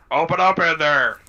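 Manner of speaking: loud pounding demand; shouting guard. Acted. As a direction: bark it through the door. Guard banging on door.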